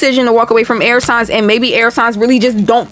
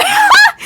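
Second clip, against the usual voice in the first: high shrieking burst of laughter. Real, not acted. Reader cracks up at her own lyric slip, shrieking laugh.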